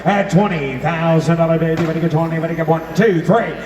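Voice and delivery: high-pitched rolling auction chant; frantic trading floor shouting. Acted. Auctioneer calling for bids.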